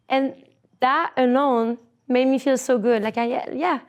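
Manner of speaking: normal talking voice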